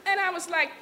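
ordinary delivery

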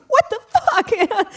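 high, drawn-out squeal of exasperation; slow high-pitched comic disbelief; exasperated outburst. Retold or imitated. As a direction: whine it slowly, way up high. Exasperated nobody talks to her.

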